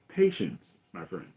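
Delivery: a hint of the Emperor, trailing to a hush; Emperor's soothing line. Emperor calming hasty Vader, lightly voiced and hushed. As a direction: ease it out, fading to a near whisper.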